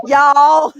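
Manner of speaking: a loud, high, faked Southern "Y'all"; Southern twang. Shown as a demonstration. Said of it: "doing the Southern twang".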